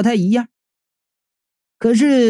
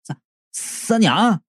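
halting, softer stammer; 3 dB quieter, much slower than usual. A narrator's reading. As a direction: stumble over the name in excitement. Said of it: "结结巴巴地说". (激动得结结巴巴)

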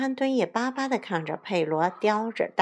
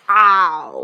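long, very loud wolf howl; 12 dB louder than usual. Acted. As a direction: throw back a big drawn-out "ao" howl. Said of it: "传来一声狼嚎". (响亮悠长的狼嚎)

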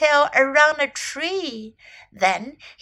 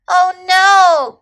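high, loud wail; dismayed cry; 6 semitones higher, 11 dB louder than usual. A narrator's reading. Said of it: "cried Little Elephant".

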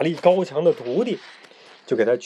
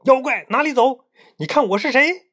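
high, raised-voice hero's challenge; loud challenge; 9 semitones higher, 4 dB louder than usual. A narrator's reading. Monkey King grabs the fleeing demon, loud high challenge. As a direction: call out sharply, much higher and louder. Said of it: "大声说".

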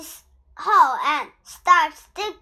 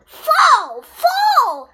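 very high, loud furious roar; furious roaring king; 13 semitones higher, 7 dB louder than usual. A narrator's reading. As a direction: bellow it out at the melon. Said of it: "King Bear roared".

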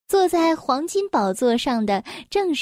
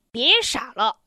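understated contempt; scornful; 2 semitones lower than usual. A narrator's reading. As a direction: dismiss it with mild scorn. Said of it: "轻蔑地说".

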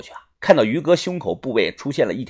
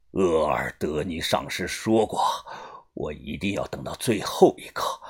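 low, soft self-talk as if entranced; talking to himself; 12 semitones lower, 9 dB quieter than usual. A narrator's reading. Old Fu talks to himself over the basket, in a dazed low mutter. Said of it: "自言自语的说道".

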